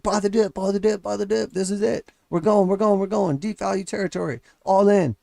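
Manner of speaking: gleeful mock investor hype; mock hyped dip buyer. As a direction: lampoon the eager retail crowd.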